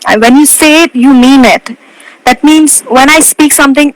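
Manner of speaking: ordinary talk, nothing marked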